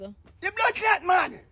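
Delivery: slow, high furious shout; angry shout. Acted. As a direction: blow up at her. Angry outburst at Bella.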